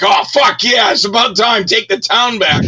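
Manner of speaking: high, loud exultant cheer; watching Bronson hit the street, cheering him on. Retold or imitated. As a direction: whoop like the hero finally struck back. Cheering Charles Bronson on screen.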